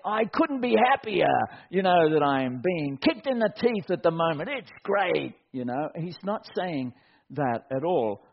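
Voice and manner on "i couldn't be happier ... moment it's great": mock happiness. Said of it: "all bubbly and happy".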